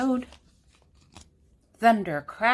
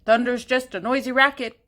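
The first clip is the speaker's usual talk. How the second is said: loud, fast, higher duck voice; 4 semitones higher, 6 dB louder, faster than usual. A narrator's reading. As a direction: quack it out as loud as he can. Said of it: "loud as he could quack it".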